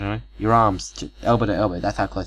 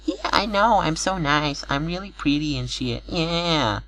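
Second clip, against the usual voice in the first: put-on much higher, airy vain-girl voice; 8 semitones higher, slower than usual. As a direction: play a smug pretty girl bragging about herself.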